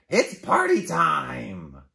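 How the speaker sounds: slow, loud, gleeful party call; excited bird voice. Acted. Bird announcing a party to friends, excited.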